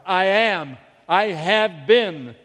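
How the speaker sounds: loud, exasperated insistence; irritated cry. Retold or imitated. Irritated mother answering the inner voice, crying out in exasperation. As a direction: cry it out, leaning hard on each word. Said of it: "Beginning to get irritated, Lenidra cried".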